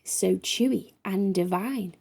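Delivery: usual speaking voice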